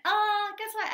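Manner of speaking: high, loud excited squeal. Real, not acted. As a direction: burst out with excitement. Excited surprise at a number.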